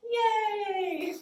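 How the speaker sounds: high, loud yay; cheer. Real, not acted. Cheering after the song.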